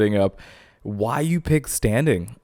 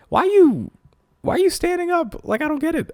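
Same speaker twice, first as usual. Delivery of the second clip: much higher, incredulous questioning; baffled high pitch. Real, not acted. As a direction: voice shoots up in bafflement. Baffled by standing wipers.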